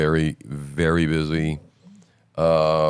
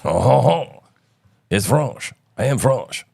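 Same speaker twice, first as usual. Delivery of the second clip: put-on playful French Canadian accent, pitched up; 6 semitones higher, much slower than usual. Showing the co-host he can do a French Canadian accent. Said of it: "I can just do the French Canadian accent".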